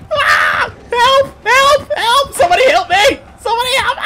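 very high, loud shrieks for help; terrified screaming. Real, not acted. Caught player shrieking at anyone to help, terrified. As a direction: scream like you're being dragged away.

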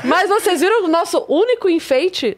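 laughing. Real, not acted. Laughing then talking.

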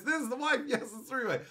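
high mock wife voice; a hint of a fake wife. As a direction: put on a silly wife voice. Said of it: "Tony says".

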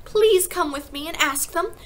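high, loud, drawn-out pleading; frantic little girl, pitched high; urgent shout. A narrator's reading. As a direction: beg them to hurry, crying out. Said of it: "she shouted".

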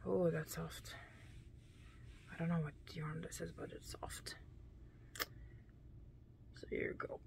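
very soft quick whisper; fading whisper over soft yarn. Real, not acted. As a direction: murmur it in a whisper, fast. Whispering while feeling yarn.

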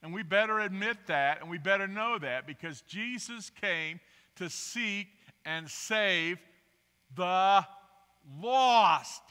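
loud, emphatic pulpit preaching. Acted. Preacher proclaiming to his congregation, loud and emphatic. Preaching opening at full force.

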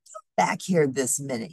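plain reading voice

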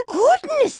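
high startled "Goodness" from a granny; startled gasp. Acted. Old lady startled at race.

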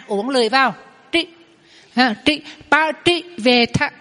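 trị with the tongue curled back; retroflex tr demo. Shown as a demonstration. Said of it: "mình đọc chữ trị ha".

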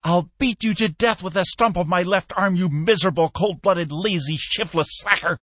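high and loud, hurling insults; hoarse raging threat. A narrator's reading. Peck screams insults at Skinner, hoarse with rage. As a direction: rage at him with every word. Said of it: "he promised hoarsely".

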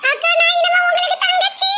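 put-on high, sugary girlish voice; new bride voice. New bride greeting Geetha in a high sugary voice. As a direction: coo like a cheeky young bride.

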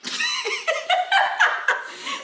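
giggling high laugh; high burst of laughter. Real, not acted. Dog ignores command.

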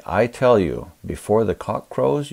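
ordinary talk

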